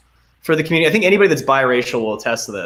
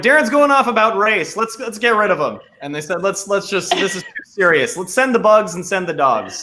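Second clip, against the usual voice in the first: higher, hammed-up scheming voice; mock voice of nature; 7 semitones higher than usual. Joking that nature sent bugs to stop him. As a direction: play nature as a plotting villain.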